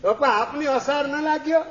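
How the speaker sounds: put-on high, childlike asking voice; a small boy tugging at his father with a question; curious little son. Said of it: "counter question આવશે". (નાના દીકરાનો ઊંચો, કુતૂહલભર્યો અવાજ)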